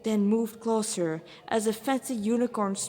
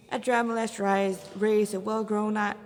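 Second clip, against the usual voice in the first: ordinary delivery, nothing marked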